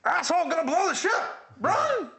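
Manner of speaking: high frantic screamed alarm; screaming self-destruct voice. Shown as a demonstration. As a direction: scream a panicked ship warning. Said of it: "that's my self-destruct voice".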